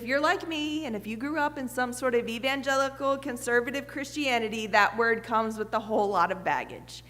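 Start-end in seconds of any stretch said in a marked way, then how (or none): none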